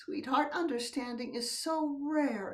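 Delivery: normal talking voice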